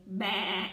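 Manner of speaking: loud playful bleat; sheep bleat. Retold or imitated. Imitating a sheep's bleat for the riddle. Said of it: "And I say".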